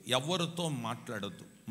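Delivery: usual speaking voice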